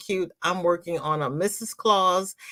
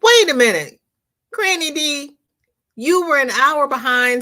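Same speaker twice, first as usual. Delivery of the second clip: loud, high double take; sudden surprise; 6 semitones higher, 5 dB louder than usual. Real, not acted. Host noticing Granny already home, loud and surprised. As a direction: stop short in disbelief.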